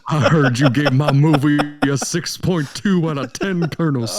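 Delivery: hammed-up [name] picking a bone; [name] impression. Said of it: "[name] is back".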